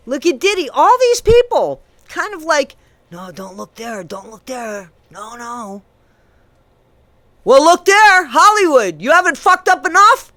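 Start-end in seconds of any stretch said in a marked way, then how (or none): 3.1-5.8 soft, hushed mock plea to look away; mock hushed deflecting. Mocking celebrities hiding their scandals, soft mock deflection. 7.4-10.3 high, loud, incredulous outburst at Hollywood; angry shout. Real, not acted.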